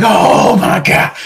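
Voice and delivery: high, drawn-out exclamation of relief; retold huge relief. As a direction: stretch it out, hugely relieved. Retold relief after being let go.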